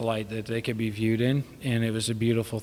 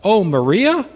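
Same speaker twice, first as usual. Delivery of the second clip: high, loud comic voice of his dad; imitating dad; 7 semitones higher, 6 dB louder than usual. Son mimics his father forgetting the ladies' names, high and loud. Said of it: "it was so funny 'cause he's like".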